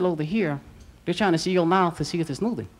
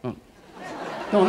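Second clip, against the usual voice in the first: normal talking voice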